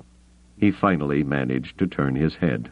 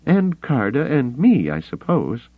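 higher, dry woman's voice; teasing woman; 9 semitones higher, 3 dB louder than usual. Acted. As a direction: tease him back dryly. Mara voiced.

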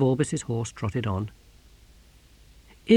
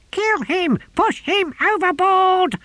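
very high, loud shriek, drawn out at the end; screaming tortoise; 5 dB louder, slower than usual. A narrator's reading. Tortoise urging the novice to murder, high furious scream. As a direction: shriek the order from inside a box.

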